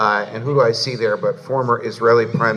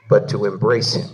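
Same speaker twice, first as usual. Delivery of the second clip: usual speaking voice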